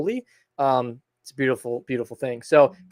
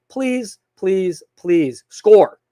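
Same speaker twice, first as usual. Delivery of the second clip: loud, higher pleading on each please; exasperated plea; 5 semitones higher, 8 dB louder, much slower than usual. Acted. Fan begging the team to score, exasperated plea. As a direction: beg louder and louder, landing hard on the last word.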